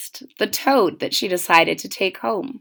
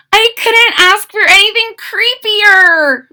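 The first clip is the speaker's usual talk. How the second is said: put-on much higher, louder girl voice; little girl, convincing herself; 10 semitones higher, 6 dB louder than usual. Mitzi reassuring herself about the bats: high, insistent girl voice. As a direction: talk yourself into being happy. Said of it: "Mitzi kept telling herself".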